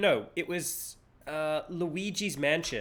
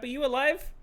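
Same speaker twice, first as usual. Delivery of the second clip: usual speaking voice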